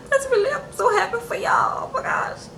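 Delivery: high whispered mock delight; whispered fake joy. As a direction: breathe it out as forced happiness. Mom fakes joy for the couple.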